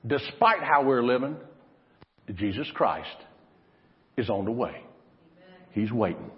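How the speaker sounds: ordinary talk, nothing marked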